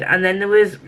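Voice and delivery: normal talking voice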